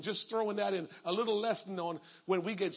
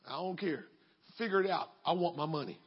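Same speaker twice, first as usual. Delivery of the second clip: low, dismissive refusal; cold creditor; 6 semitones lower than usual. Acted. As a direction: brush off his excuse. Creditor answers coldly.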